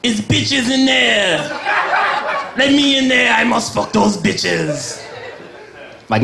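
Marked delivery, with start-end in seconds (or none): 0.0-5.0 higher, slow, lovesick cartoon skunk, laughing through it; a comedian playing a desperate skunk outside a bar; mock Pepe Le Pew. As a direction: ham up a pining skunk begging at the door, slow. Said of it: "Can you imagine Pepe Le Pew outside the bar?".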